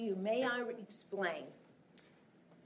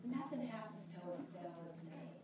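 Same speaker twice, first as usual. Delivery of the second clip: quiet repeat; 2 semitones lower, 8 dB quieter than usual. Real, not acted.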